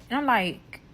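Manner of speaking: ordinary delivery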